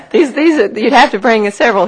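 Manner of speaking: talking while still laughing. Real, not acted. Talking through laughter after coffee joke.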